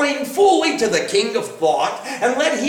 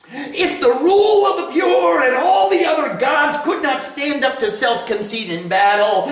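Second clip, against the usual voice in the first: scornful ambassador; 6 semitones higher than usual. Acted. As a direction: sneer down at a lone woman. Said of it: "And the ambassador said".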